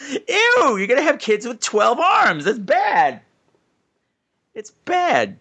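high-pitched, appalled mock yelling; yelling at screen. Retelling yelling at the film about cousins, high appalled protest. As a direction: protest with comic disgust, voice jumping up. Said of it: "I yelled at the screen".

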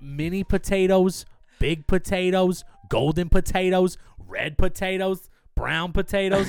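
slow listing. Acted. Listing potatoes to a friend, slow and savouring. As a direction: savour each potato name slowly.

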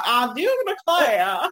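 slow, laughing Southern drawl; Foghorn Leghorn impression. Imitating the rooster for her friend, slow Southern drawl. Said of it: "He'd be like".

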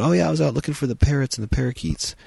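high, fast, breezy put-on voice; mock casual chatter, high and quick; silly character voice. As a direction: rattle it off like a goofy movie extra. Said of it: "they talk about".

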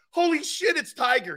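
high, starstruck exclamation; stunned blurt. Retold or imitated. Retelling the moment he walked in on Tiger, stunned high blurt. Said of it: "I was absolutely stunned".

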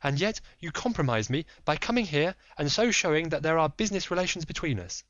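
lady pleading secrecy; Lady Hilda. Acted.

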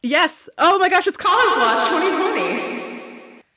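high, gleeful outburst; excited squeal. Real, not acted. Co-host seizing on the Mister Collins topic, excitedly.

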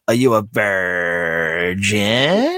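very slow leering question, last word stretched out; creepy old judge impression, drawn-out and rising; slimy old man's accent. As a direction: draw out VIRGIN at length, swooping up at the end. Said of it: "In that accent".

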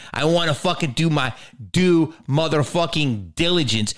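usual speaking voice

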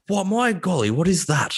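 high-pitched, hammed-up astonishment; mock bafflement. Acts baffled foreigner hearing slang.

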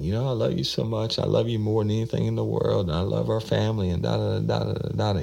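high, fast, tearful imitation of a crying woman; a retold woman crying as she confesses love; imitating her crying. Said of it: "She started crying shit again".

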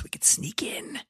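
unvoiced conspiratorial whisper. Host whispers the plan to sneak into the townhouse.

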